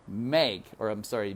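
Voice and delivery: plain reading voice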